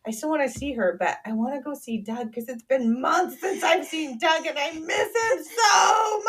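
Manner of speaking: rising to a high, loud plea; daughter's pleading tone. Retold or imitated. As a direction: plead like a little girl missing her dog. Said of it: "That was her tone of voice and everything".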